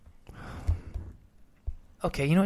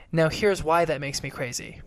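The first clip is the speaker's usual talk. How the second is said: ordinary talk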